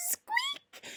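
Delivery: very high, soft mouse squeak; tiny squeak. A narrator's reading. Frightened mouse squeaking at the lion.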